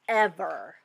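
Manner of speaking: usual speaking voice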